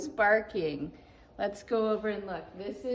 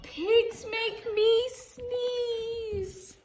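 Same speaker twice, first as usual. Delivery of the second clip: slow whine; 11 semitones higher, 5 dB louder, much slower than usual. Acted. Gerald laments to Doctor Cat.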